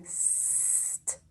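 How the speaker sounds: "st" as a long s ending in t; isolated blend. Shown as a demonstration. Teacher models the blend for pupils, isolated blend. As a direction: hold the s of "st", no vowel. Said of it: "That is the blend".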